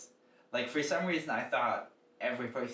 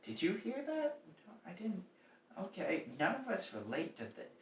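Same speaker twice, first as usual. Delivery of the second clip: imitating hushed, high-pitched crowd confusion; soft, high mock murmur between audience members; puzzled audience aside; 6 semitones higher, 7 dB quieter than usual. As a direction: confide your bafflement to the person beside you, quietly.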